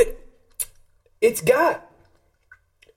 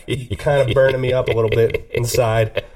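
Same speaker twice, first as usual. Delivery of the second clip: ordinary talk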